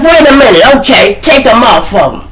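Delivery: loud, high-pitched halt; urgent objection. Acted. Mother halting her boy's arrest, urgent shout. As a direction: burst in to stop them.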